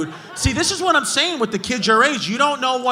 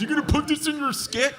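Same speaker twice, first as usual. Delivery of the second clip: slightly higher sneering taunt; a touch of a sneering heckler; mock heckler; 4 semitones higher, 4 dB quieter than usual. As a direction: imitate the smug heckler lightly. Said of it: "I love when people say that to comedians".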